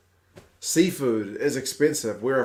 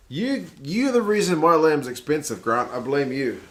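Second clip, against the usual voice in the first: higher and louder, playful blame; mock accusation; 4 semitones higher, 6 dB louder than usual. Streamer teasing viewer Grant for the lamb prices, mock accusing. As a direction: point the finger at him jokingly.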